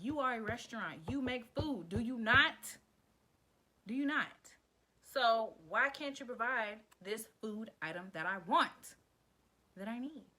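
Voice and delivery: loud exasperated pleading, slowing down; loud frustrated demand. Retold or imitated. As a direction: demand it like a fed-up customer. Child demanding from restaurant parent.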